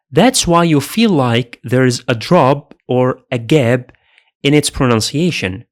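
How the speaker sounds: normal talking voice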